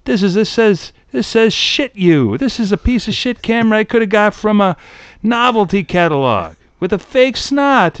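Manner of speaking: high-pitched cheated customer; hammed-up indignant complaint; mock outrage. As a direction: rant like a swindled buyer, pitch pushed up. Acts an outraged camera buyer.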